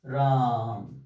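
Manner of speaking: राम with the middle aa stretched out; राम held long, falling at the end; prolonged vowel. Shown as a demonstration. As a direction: draw out राम well beyond a long vowel. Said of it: "दीर्घ स्वर से भी ज्यादा समय लगता".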